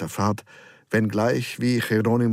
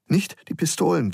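higher-pitched, restrained plea; pleading, a little less alarmed; pleading repeat; 6 semitones higher than usual. A narrator's reading. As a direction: beg gently, with the alarm reined in. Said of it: "ein wenig leiser, bittend".

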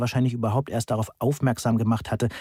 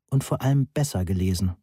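ordinary delivery

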